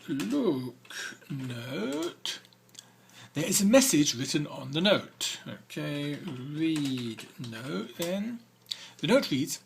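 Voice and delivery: ordinary delivery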